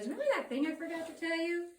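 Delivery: a hint of a teasing friend; lightly voiced friend. Friend Oscar recalling his forgotten warning, lightly voiced. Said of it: "He goes".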